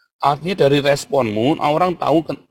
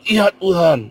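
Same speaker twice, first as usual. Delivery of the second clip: raised, louder cry of repentance; acted plea to God; 6 semitones higher, 6 dB louder, slower than usual. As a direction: call out to God as a penitent. Memerankan orang bertobat berseru kepada Tuhan. (seruan tobat kepada Tuhan, lantang)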